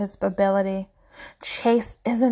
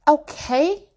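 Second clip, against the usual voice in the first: higher, louder demanding "Okay?"; insistent; 6 semitones higher, 3 dB louder than usual. Acted. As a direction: press him for an answer. Mother repeats demanding an answer.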